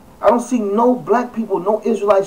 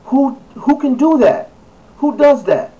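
who could do this, appalled and higher; incredulous outrage; 8 semitones higher, 4 dB louder than usual. Real, not acted. Vlogger appalled at hunters posing with dead lion, incredulous. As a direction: pitch jumps way up in disbelief.

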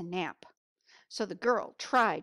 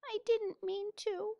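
sorry; 13 semitones higher than usual. Acted. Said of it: "the girl cried".

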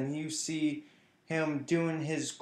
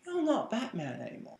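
put-on higher-pitched English accent; Alfred; 6 semitones higher, 4 dB quieter than usual. Butler to a patched-up Batman, higher English accent. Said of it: "that's my English accent".

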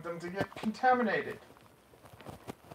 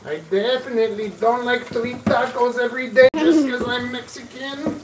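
put-on Mexican accent; 5 semitones higher, 11 dB louder than usual. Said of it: "Mexican accent".

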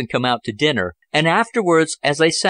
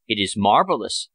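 ordinary delivery, nothing marked

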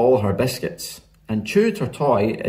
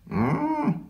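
high, humming seal moan; soft animal mm; distant seal call; 4 dB quieter than usual. A narrator's reading. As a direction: call faintly like a faraway seal. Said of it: "far away there came a".

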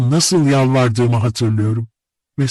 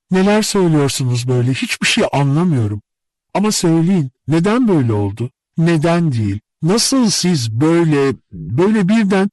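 higher, flustered, faltering questions; utterly confused; 7 semitones higher than usual. A narrator's reading. Nastenka hearing his love confession, deeply confused. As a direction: start flustered, then falter into bewilderment. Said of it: "Aklı sahiden çok karışmıştı".

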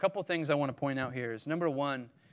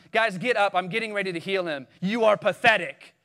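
loud, higher-pitched mock contempt; mock scolding; 4 semitones higher, 6 dB louder than usual. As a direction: berate the mourners in pretend impatience. Mocking an unfeeling Jesus scolding mourners.

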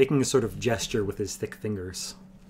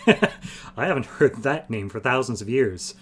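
slow words through a laugh; 3 semitones higher, 4 dB louder, slower than usual. Acted. Wolf amused at hearing his old name, laughing. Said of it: "then laughed".

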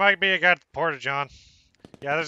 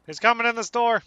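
high alarmed call; alarmed shout; 7 semitones higher, 3 dB louder than usual. Real, not acted. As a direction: warn of the enemy, tense. Enemy at the door.

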